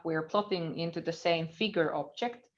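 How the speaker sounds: usual speaking voice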